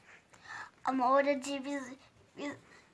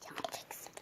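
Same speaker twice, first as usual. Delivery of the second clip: faint menacing whisper; barely audible warning; whispered threat; 19 dB quieter than usual. Acted.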